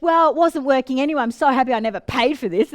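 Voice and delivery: fast, loud huffy comeback; angry retort. Retold or imitated. Client storming off from the coach, fast angry huff. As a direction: brush the coach off, quick and cross. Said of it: "And then they get angry with the coach".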